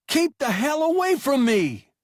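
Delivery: high, loud scream; a bleeding boy screaming at his captain. A narrator's reading. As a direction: scream to keep him away. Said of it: "the boy screamed".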